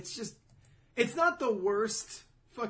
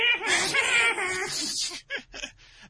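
high, loud mocking cackle; imp's cackle; 8 dB louder than usual. Devil imp taunting his rivals, mocking cackle. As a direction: cackle like a gleeful little devil. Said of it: "the little panel of him like".

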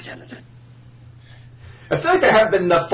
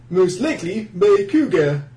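ordinary talk

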